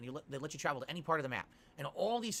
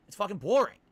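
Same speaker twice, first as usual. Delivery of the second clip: loud rant; 3 semitones higher, 9 dB louder than usual. Real, not acted. Ranting about dull side quests.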